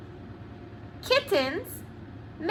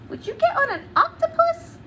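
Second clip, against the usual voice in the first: high, fast, incredulous; mock disbelief; 12 semitones higher, faster than usual. Teacher teasing children with a silly option, incredulous. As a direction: sound amazed at the idea. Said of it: "teasing question".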